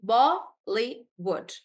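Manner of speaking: "Bollywood" slow, Bol-ly-wood; syllable by syllable. Shown as a demonstration. Teacher correcting Tejas to three, syllable by syllable. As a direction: count out three beats in "Bollywood". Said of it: "it's three".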